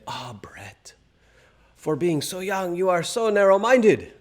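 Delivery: imitated deep French accent, hushed then rising. French friend gently scolding the speaker, thick French accent. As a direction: do your friend's thick French accent, opening on a breathy sigh. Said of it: "in his deep, thick French accent".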